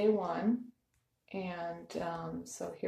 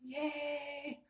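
soft, high, drawn-out cheer; hushed cheer; 7 semitones higher, 6 dB quieter than usual. Real, not acted. Dieter celebrating while family is still sleeping, hushed cheer. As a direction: cheer high but keep the volume down. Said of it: "I'm trying to be quiet".

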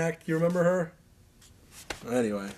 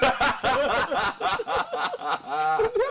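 breaks into a high laugh; can't hold the laugh in; laughing mid-story; 11 semitones higher than usual. Real, not acted. Laughing while retelling.

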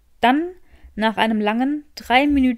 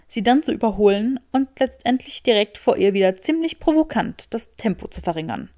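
ordinary delivery

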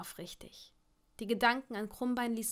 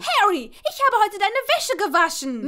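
high, loud indignant retort; squabbling; 10 semitones higher, 13 dB louder than usual. A narrator's reading. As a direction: throw the chore back at him. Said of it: "wie die beiden sich rangelten".

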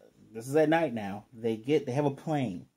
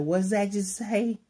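put-on high, slow drunken question; playful drunk impression; slurring drunk; 9 semitones higher, 4 dB louder, slower than usual. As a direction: imitate a tipsy slur, drawn out and raised. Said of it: "she's slurring her words".

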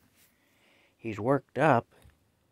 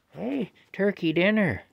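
excited tease; 9 semitones higher than usual. Real, not acted. Tempting the cat with food.

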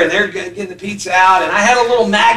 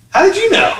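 put-on much higher, astonished little boy's question; caught-out kid; 10 semitones higher, faster than usual. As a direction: gasp it out like a caught child, high. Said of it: "And he goes".

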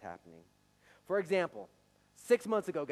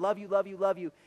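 loud, higher, sing-song autopilot repeats; rote love you; 7 semitones higher, 11 dB louder than usual. Retold or imitated. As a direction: rattle it off without meaning it. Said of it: "we just tack it on".

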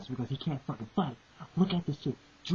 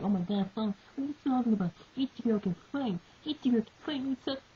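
high, whiny mock fan voice; mocking the defenders; 9 semitones higher than usual. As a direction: lampoon a fan defending his hero. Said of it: "He got people defending Ichigo like".

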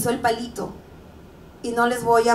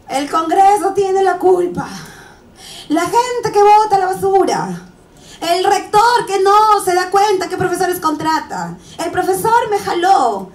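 raised, higher voice tossing out blame; loud blaming; 7 semitones higher, 6 dB louder than usual. Retold or imitated. Speaker voicing people who blame everyone, loud and indignant. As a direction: rattle off whom to blame, loud and high.

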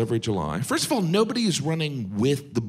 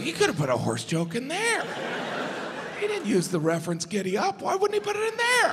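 heckler complaining; 11 semitones higher, faster than usual. Pretend. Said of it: "you're gonna be like".

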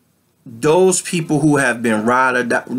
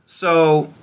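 ordinary delivery

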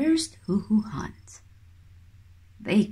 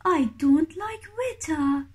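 high little chick voice, pouting; fussy chick; 8 semitones higher than usual. Acted. Baby kiwi refusing weta, high and pouty. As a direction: pout it out like a picky baby bird. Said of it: "says Hooey".

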